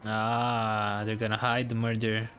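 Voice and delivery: usual speaking voice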